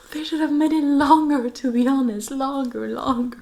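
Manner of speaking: high, loud, exasperated whine; whiny complaint. Real, not acted. Fan protesting the short trailer, whiny and loud. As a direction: complain loudly, pitched right up.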